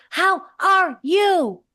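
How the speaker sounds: high, slow parrot voice, well above his own; a bird's sing-song "How are you?", drawn out and high; squawky parrot. Retold or imitated. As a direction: mimic a talking parrot stretching each word. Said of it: "and he goes".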